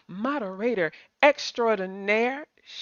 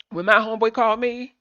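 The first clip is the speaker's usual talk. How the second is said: ordinary talk, nothing marked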